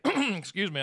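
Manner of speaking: ordinary delivery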